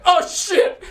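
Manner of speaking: high, loud, laughing shriek; hammed-up scared shout; startled scream. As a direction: yelp in terror. Demon lunges at him.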